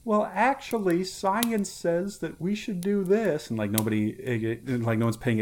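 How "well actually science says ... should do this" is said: higher, slow smug know-it-all; lightly mock the pedantic one. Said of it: "the one person saying, like".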